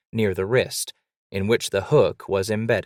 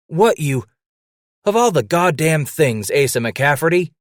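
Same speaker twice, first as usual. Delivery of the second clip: shocked disbelief; 7 semitones higher, 5 dB louder than usual. Acted. Shocked at friend's hook.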